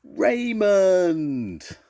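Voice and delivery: high, long, booming call; loud shout. A narrator's reading. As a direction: shout the name out loudly and draw it out. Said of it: "shouted out loudly".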